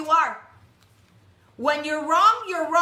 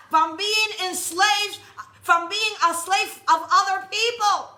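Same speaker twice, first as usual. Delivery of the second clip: preaching; 3 semitones higher, 3 dB louder, slower than usual. Acted. Preaching builds to full force.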